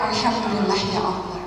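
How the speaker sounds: soft, low murmur of thanks to God; quiet devout thanks; hushed grateful prayer. Retold or imitated. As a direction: breathe it out like a private prayer. Someone's grateful inner prayer.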